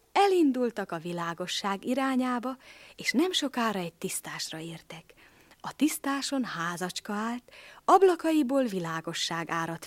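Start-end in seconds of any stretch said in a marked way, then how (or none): none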